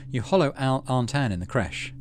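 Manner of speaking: lively read-aloud of the chosen option; game text read aloud. Acted. Streamer reading out the vegetarian-option choice, lively narration.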